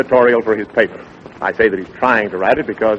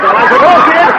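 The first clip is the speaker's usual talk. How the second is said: high, loud startled cry; excited outburst; 13 semitones higher, 8 dB louder, slower than usual. Acted. Dick cries out excitedly at the lion in his story.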